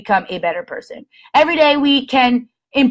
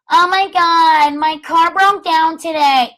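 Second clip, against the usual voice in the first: mock whiny complaint; 5 semitones higher, 3 dB louder than usual. Mocking someone's complaining overshare.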